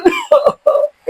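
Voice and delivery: high-pitched burst of laughter; high gleeful laugh; teasing cackle. Acted. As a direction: crack up at your own joke. Joking about crunchy skin.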